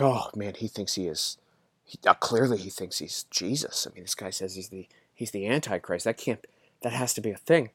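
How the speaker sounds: softly hammed-up hushed panic; mock hushed alarm. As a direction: imitate critics whispering in mock dread. Mocking panicked critics.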